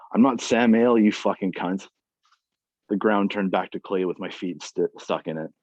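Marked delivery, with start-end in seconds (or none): 0.1-1.9 loud, furious retort; angry outburst. Acted. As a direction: spit it out in anger.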